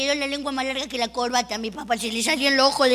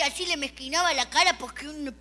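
ordinary talk

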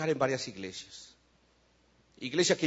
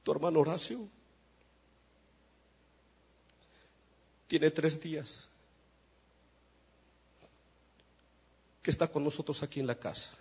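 retold tearful mother; 3 semitones lower, 6 dB quieter than usual. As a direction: quietly imitate a mother speaking through tears. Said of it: "mi mamá llorando me dijo".

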